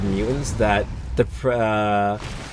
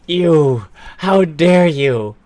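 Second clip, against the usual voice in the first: louder, higher, slow furious line; shaking with anger; 6 semitones higher, 5 dB louder, much slower than usual. Acted. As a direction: spit it out in slow rage. Character rage at Katsu.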